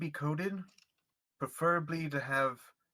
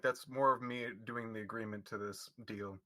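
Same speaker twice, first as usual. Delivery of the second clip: ordinary talk, nothing marked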